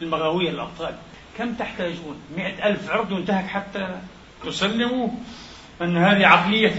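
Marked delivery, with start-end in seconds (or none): 1.4-2.2 hushed, sneering echo of the question; quiet mock quote. Preacher quotes the so-called heroes in a hushed sneer. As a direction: drop it much softer as a scornful quote. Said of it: "كما تساءل أحد ال- المغاوير الأبطال".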